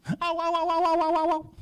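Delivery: very high, loud string of ows; a lion hopping about in cartoon pain; hammed-up ouch. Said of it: "He was like".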